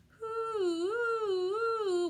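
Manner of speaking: high hooting siren call; siren hoo. A narrator's reading. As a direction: wail it like a siren. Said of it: "went that noise".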